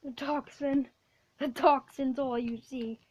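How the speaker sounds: ordinary talk, nothing marked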